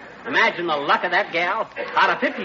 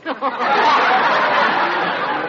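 higher giggling laugh trailing on; 6 semitones higher, 4 dB louder than usual. Acted. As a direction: chuckle on, pleased with himself. Keeps laughing at pun.

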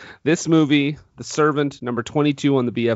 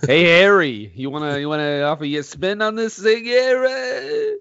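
Joseph Losey; 6 semitones higher than usual. Pretend. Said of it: "It's me, Joey Losey".

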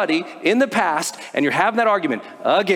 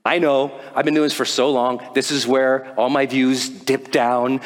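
ordinary talk

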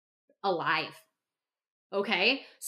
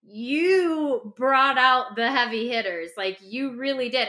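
impressed reader; 4 dB louder, slower than usual. Retold or imitated. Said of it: "they're like".

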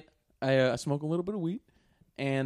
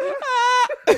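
mock sob; 10 dB louder than usual. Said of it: "But no, she's crying".

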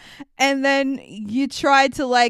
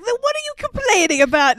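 exasperated father; 11 semitones higher, faster than usual. Retold or imitated. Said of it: "he's like".

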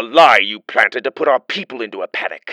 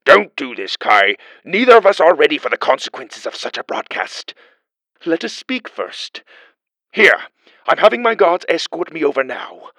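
louder, urgent pleading; frightened official pleading, louder; urgent plea; 6 semitones higher, 7 dB louder than usual. Acted. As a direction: beg him to stop the broadcast.

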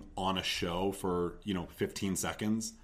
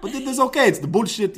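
loud, hammed-up Israeli accent, higher and louder with laughter; mock Israeli accent, building to a high laughing squeal; hammed-up Israeli accent played for laughs; 5 semitones higher, 7 dB louder than usual. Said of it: "I was like".

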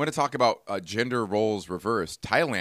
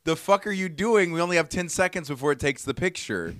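high, loud frantic rush; mock exasperated yell; 8 semitones higher, 4 dB louder than usual. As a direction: hurry him up, exasperated. Yelling about the timer.

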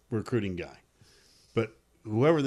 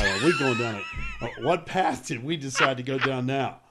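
laughing; 3 semitones higher than usual. Real, not acted.

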